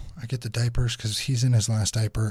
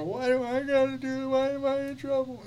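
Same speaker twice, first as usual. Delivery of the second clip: put-on much higher whining little girl; whiny kid; 13 semitones higher, 4 dB quieter than usual. Daughter protesting her punishment, high whiny voice. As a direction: whine it like a kid sent to timeout.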